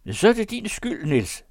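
high, loud reproach from the poacher; condemned poacher. Acted. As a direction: put the blame on him, pitched high. (højlydt, bebrejdende krybskytte)

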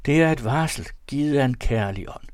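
high, loud burst of conviction; exclaimed cry. A narrator's reading. Narrator proclaims an omen, crying out high and loud. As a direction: cry it out as a revelation. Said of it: "råbte jeg".

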